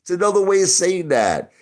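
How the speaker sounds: normal talking voice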